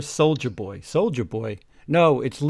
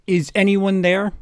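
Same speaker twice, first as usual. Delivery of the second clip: yelled; 5 semitones higher, 4 dB louder than usual. Acted. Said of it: "yelled Mister Norris".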